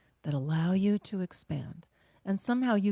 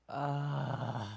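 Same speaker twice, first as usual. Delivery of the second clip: heavy groan; 5 semitones lower, 6 dB quieter than usual. Retold or imitated. Heavy sluggish feeling sound.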